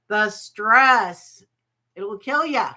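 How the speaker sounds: ordinary talk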